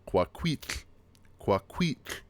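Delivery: QUAQUITL as kwa-KWEETL, ending in a voiceless tl; pronunciation demo. Shown as a demonstration. Teacher gives an example of the Q sound, careful demo. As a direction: model QUAQUITL carefully, whispery tl at the end. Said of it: "which is pronounced qua".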